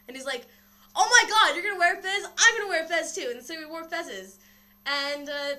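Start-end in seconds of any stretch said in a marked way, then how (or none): 1.0-3.3 loud, high gushing; mock-thrilled retold squeal; excited. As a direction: act out over-the-top delight, voice way up. Acts doctor thrilled about fezzes.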